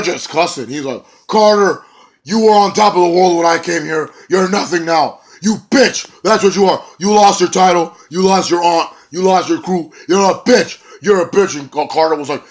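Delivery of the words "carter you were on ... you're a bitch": loud, high insulting tirade; angry shout. Retold or imitated. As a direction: scream insults at him. Bennett and Carter cussing each other.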